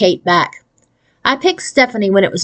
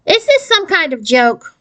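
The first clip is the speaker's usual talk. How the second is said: put-on high squeaky boy's voice; a lightly voiced squeaky nerd, suspicious; squeaky nerdy boy; 9 semitones higher than usual. A narrator's reading. Boy on the phone asking if it's a joke, high and squeaky. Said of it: "Robert squeaked".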